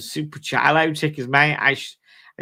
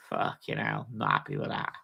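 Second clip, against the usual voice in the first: low grumble; 10 dB quieter than usual. Real, not acted. Grumbling about overpriced watch.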